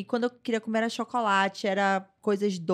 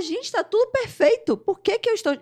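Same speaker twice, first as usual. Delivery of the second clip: much higher and louder, anguished; distressed retold self; 12 semitones higher, 6 dB louder than usual. Retelling how she cried to her family, high and louder in anguish. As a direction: replay the panic of crying for no reason. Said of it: "Eu falava".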